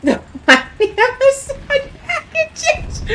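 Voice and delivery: high, slow words squeezed through laughter; cracking up mid-sentence; helpless laughing. Real, not acted. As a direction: barely get it out for laughing. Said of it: "I can't stop laughing at this".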